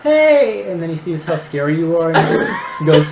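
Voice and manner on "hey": high, loud "hey" of protest; indignant call-out. Acted. Diner catching someone taking their food, indignant call-out. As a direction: call out sharply at a food thief.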